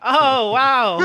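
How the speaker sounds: sarcastic wow. Acted. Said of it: "Novel idea".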